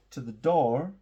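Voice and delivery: ordinary delivery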